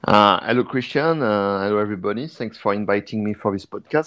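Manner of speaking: ordinary talk, nothing marked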